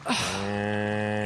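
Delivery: sigh. Real, not acted. Weary at Agent Jones.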